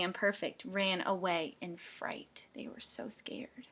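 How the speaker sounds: scared hushed whisper; frightened whisper. Acted. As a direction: whisper it as if hiding. Penguins hide in fright.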